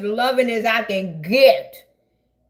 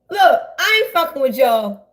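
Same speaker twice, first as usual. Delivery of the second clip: usual speaking voice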